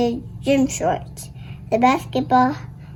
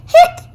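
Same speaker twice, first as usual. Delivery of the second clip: one high loud hiccup; squeaky hiccup; 8 dB louder than usual. Acted. Girl hiccuping while friends think, high squeak.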